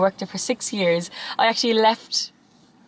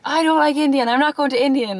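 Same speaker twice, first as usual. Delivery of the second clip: higher, louder, mock stubborn protest; a touch of petulant refusal; 7 semitones higher, 4 dB louder than usual. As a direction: whine it like a picky kid refusing dinner. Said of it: "and I was like".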